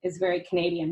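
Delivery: ordinary talk, nothing marked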